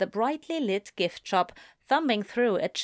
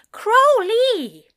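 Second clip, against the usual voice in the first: high, loud alarmed angel; alarmed shout; 9 dB louder, slower than usual. Acted. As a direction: shriek a warning, pitched up. Driver searching phone while driving.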